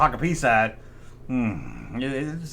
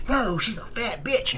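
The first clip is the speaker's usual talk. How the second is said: higher, slower put-on kid voice; rude kid reply; 6 semitones higher, 5 dB quieter, slower than usual. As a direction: sneer back as a bratty schoolkid. Kid's rude walkie-talkie reply.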